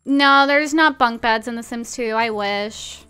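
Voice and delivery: normal talking voice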